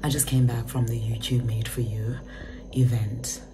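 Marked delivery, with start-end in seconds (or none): none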